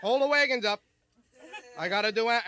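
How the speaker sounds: lightly voiced cowboy; a hint of a gruff western star; Ward Bond. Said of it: "How about Ward Bond?".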